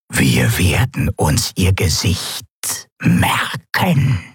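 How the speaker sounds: slow, low otherworldly threat; Sylravan. Acted. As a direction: draw out every word menacingly. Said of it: "sagte Sylravan". (langsame, tiefe, drohende Wesensstimme)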